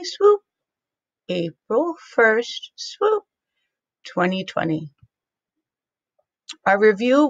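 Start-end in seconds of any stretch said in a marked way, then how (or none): 0.0-0.4 swooping rise. Shown as a demonstration. Teacher marking the comma for kids, swooping up. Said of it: "swoop gesture for punctuation". 2.8-3.2 swooping rise. Shown as a demonstration. Teacher marking the comma for kids, swooping up. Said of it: "swoop gesture for punctuation".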